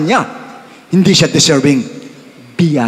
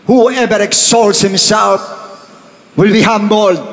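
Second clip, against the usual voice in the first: much higher, louder, slow proclamation; proclaiming scripture; 8 semitones higher, 4 dB louder, slower than usual. Acted. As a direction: boom the verse out like a warning. Reads the Lord's words loudly. (malakas at mataas na pagpapahayag ng talata)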